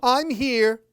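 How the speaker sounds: high, loud reassurance; mother mummy rushing in loudly; loud rescuing call. Acted. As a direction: call out to save the baby. Big Mama Mummy rescues.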